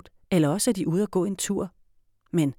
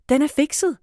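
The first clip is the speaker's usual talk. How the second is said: proud; 9 semitones higher, 6 dB louder than usual. A narrator's reading. Girl reports success proudly.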